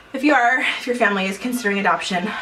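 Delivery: ordinary talk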